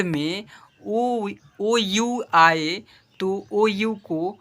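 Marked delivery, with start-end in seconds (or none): none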